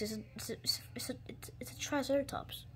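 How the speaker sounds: hushed, awed whisper. Acted. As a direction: breathe it out in awe. Awed at the new dinosaur.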